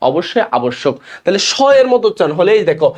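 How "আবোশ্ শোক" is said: আবশ্যক said 'a-bosh-shok'; 'sh' sound in আবশ্যক. Shown as a demonstration. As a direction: say আবশ্যক with a doubled hushing sh. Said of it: "এস এইচ এর মতো উচ্চারণ".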